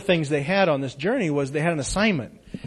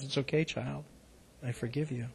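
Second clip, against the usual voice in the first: much softer, gentle voice of God; tender God voice; 10 dB quieter than usual. A narrator's reading. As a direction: speak as a loving God, hushed.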